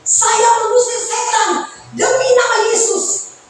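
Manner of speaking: high, sung protest to God; pleading condemned preacher. Pretend. Condemned preacher pleads with God, high sung protest. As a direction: beg God like a man refused heaven.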